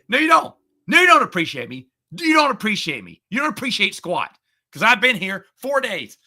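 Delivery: loud, high, furious repeat of no, you don't; telling him off at full volume; retold yelling. To the kid who brought the loaner, shouting angrily.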